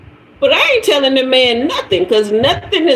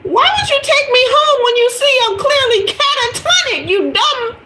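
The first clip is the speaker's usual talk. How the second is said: much higher, indignant inner rant; outraged inner voice. Retold or imitated. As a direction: fume high in your head at him. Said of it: "thinking to myself".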